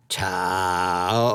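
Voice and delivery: creature voice, puzzled question. A narrator's reading. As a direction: ask it like a beast who never heard the word. Gold Lock puzzled by the word.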